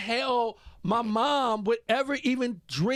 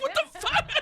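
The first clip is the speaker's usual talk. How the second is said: high, laughing "What the fuck?"; stunned disbelief through laughter; shocked dad. Retold or imitated. Dad caught off guard by son screaming, laughing shock. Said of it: "I'm like".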